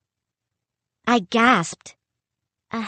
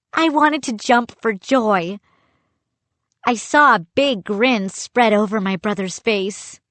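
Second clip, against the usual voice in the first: ordinary delivery, nothing marked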